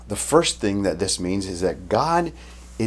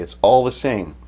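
ordinary talk

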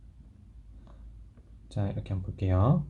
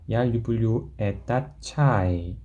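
slow model sentence; much slower than usual. Shown as a demonstration. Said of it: "teacher models slow sentence".

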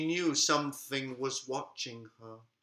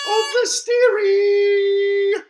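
grand finale; 11 dB louder, much slower than usual. Acted. Grand story ending.

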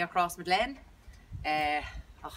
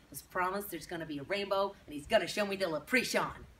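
put-on syrupy Texan accent, chirpy and eager; Texas Rose accent. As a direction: gush like a Texas beauty queen introducing herself. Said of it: "I'm your Texas Rose".

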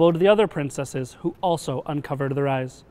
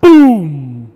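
big, booming sound word; explosive storybook boom; loud boom; 14 dB louder than usual. A narrator's reading. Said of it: "a loud boom".

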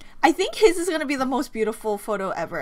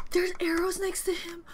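ordinary talk, nothing marked

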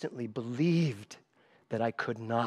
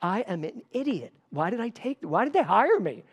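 panicked inner voice; 7 semitones higher than usual. Retold or imitated. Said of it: "And I'm like".

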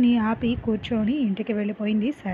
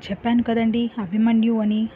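ordinary delivery, nothing marked

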